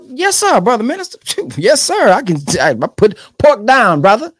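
high, eager yes-sir voice; hammed-up obedient follower; mock eager follower. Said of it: "eager follower answering".

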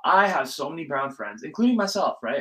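ordinary talk, nothing marked

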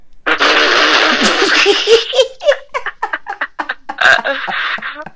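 long high laughter. Real, not acted. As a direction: burst out laughing. Laugh after Navy ship joke.

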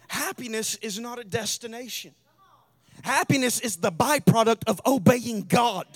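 loud, high-pitched sermon climax; full-force preaching. Real, not acted. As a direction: drive every phrase home to the congregation.

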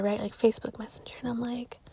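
quick hushed whisper. Real, not acted. Host whispering a quick aside about Messenger. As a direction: breathe it out unvoiced.

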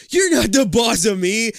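high, loud bratty taunt; smug little brother. A narrator's reading. Little brother taunting the one in charge, smug. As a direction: crow it loudly like a smug kid. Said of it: "he says smugly".

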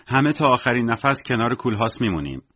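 plain reading voice

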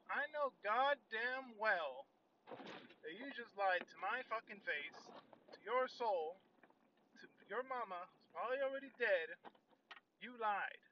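loud, high, drawn-out outrage; furious accusation. Retold or imitated.

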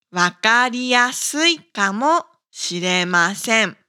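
ordinary talk

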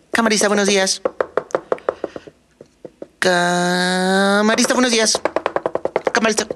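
agudo, cantado e insistente; la llamada se repite cada vez más aguda; tonito de camarista. Retold or imitated. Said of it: "lo hacen con un tonito". (camarista insistiendo, cada vez más agudo)

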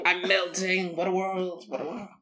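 hammed-up dying villain, fading out; melting witch. Host melting over the old format, fading theatrical death wail.

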